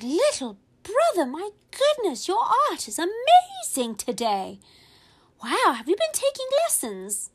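high, amazed praise; amazed teacher. Retold or imitated. Teacher amazed by the sunset picture, high delighted. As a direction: gush over the picture.

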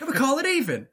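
high, loud freak-out impression; Nicolas Cage. Fan quoting the line to Doctor Who, freaking out loudly. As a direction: yell it frantically, hands-in-the-air panic. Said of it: "his hands in the air and freaking out".

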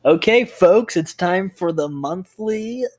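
loud, high showman's kickoff, stretched out; hammed-up announcer. Host opens the monthly movie roundup, big announcer voice. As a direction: launch the segment like a game-show host.

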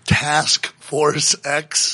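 "Task Force X" very slowly, word by word; careful tongue-twister retake. Real, not acted. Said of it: "that's a tongue twister".

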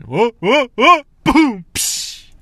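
high, loud wobbling whoas, then splash noises; acted-out fall. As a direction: imitate toppling in, whoas into a boom and hiss. Acts out falling into water.

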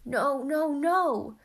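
high, very loud refusal; panicked cry. A narrator's reading. Not-so-manly lover refusing help, loud panicked cry. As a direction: wail it out in distress. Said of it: "cried the now not-so-manly lover".

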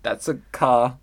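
normal talking voice

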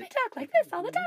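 very high, quick mock falsetto; put-on high voice. Joking about a partner's remark, silly high quick voice. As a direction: rattle it off in a silly squeak. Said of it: "in that voice, too".

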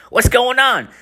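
very high put-on voice; exaggerated high-pitched phone question; boyfriend on phone. Guy asking his girlfriend on the phone, high caricature. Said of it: "And he's like".

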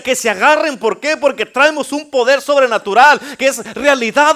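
loud, high fired-up pulpit preaching; full-force preaching. Acted. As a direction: build and roar it to the congregation. Preaching climax.

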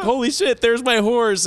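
high, stunned exclamation; shocked. Retold or imitated. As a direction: gasp it out in disbelief. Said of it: "He's like".

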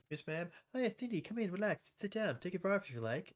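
high, put-on cooing teacher voice; mock seductive teacher. PE teacher luring Cindy into her office, high mock cooing. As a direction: coo it in a high fake sweet voice. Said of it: "And she goes".

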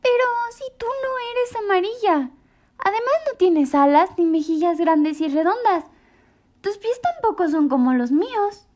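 high, loud small-bird call; a tiny bird hailing the giraffe; little bird voice. A narrator's reading. Choco doubts Mrs Bear.

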